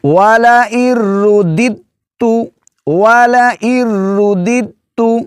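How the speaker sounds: وَلَئِن رُّدِدتُّ chanted high and slow; recited example. Acted. اس لفظ کو کیسے پڑھیں گے جی؟.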